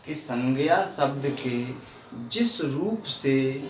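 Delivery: very slow, phrase-by-phrase dictation; slow motion. Shown as a demonstration. Teacher giving third-class students a definition, very slowly. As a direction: stretch each phrase and pause between them. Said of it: "इसलिए slow motion बोल रहा हूँ".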